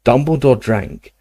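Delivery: ordinary delivery, nothing marked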